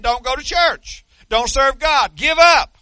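slow, building to a high shout; devil's shouted command. Pretend. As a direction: hurl it like the devil pushing you to quit. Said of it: "it's him saying".

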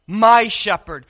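"My" pressed firmly before "shepherd"; stress on MY. Shown as a demonstration. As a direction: lean on the word "My". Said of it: "Underline that word".

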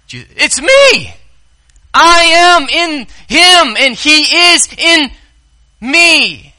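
high, loud, slow it's-me insistence; Jesus pleading with Philip, exasperated. Acted. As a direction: spell it out in disbelief.

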